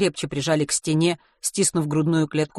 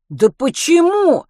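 high, loud, drawn-out why; indignant outburst; 13 semitones higher, 9 dB louder, slower than usual. Acted. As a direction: burst out in protest.

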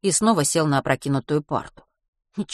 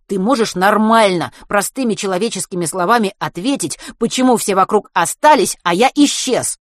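demanding; 4 semitones higher, 6 dB louder than usual. A narrator's reading. Matvey demanding answers.

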